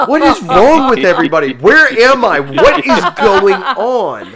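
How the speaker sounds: hammed-up disbelief, high and loud with a laugh; mock outrage. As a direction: throw up your hands at everybody. Outraged that friends prefer Zombieland.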